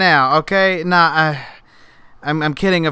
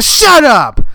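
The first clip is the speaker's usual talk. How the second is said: irritated retort; 6 semitones higher, 6 dB louder than usual. Retold or imitated. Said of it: "I'm trying to be serious".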